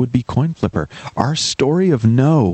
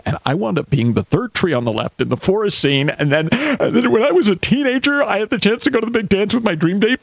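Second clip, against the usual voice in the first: higher, self-pitying lament; complaining friend; 7 semitones higher than usual. Acted. Friend lists his childhood failures to the helper, higher and self-pitying. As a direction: whine out his life of bad luck in a higher voice. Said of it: "your friend says".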